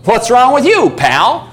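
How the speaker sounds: high, loud puppy retort, dropping at the end; baffled puppy. Acted. Puppy questions owner after belated praise, baffled retort. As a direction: question the owner as a puzzled dog. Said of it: "looks up at me and goes".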